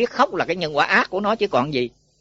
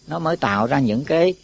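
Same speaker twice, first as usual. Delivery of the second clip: ordinary talk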